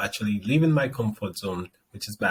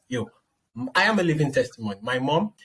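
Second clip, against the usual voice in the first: ordinary talk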